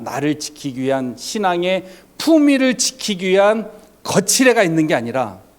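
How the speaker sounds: ordinary delivery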